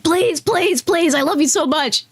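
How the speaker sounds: high-pitched pleading on its knees; begging cartoon character. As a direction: beg desperately like a cartoon, voice lifted. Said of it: "this cartoon character, like, on its knees".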